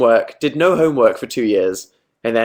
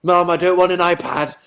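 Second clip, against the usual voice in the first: put-on high-pitched kid complaining to mum; mock pleading child; 7 semitones higher than usual. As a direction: plead like a child refusing a present. Acts a child pleading with mother.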